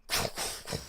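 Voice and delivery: quick voiceless bursts imitating vines; imitated rapid crackling whooshes; vine strike noise. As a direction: make vines shooting out with your mouth. Imitates three more vines striking.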